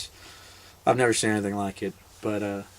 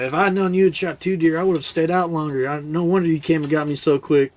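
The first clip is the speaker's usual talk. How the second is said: mildly annoyed retold complaint, higher; a little mad; 6 semitones higher, 3 dB louder than usual. Buddy learning of two deer, mildly mad. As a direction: imitate a buddy grumbling with slight irritation. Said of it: "so he was a little mad".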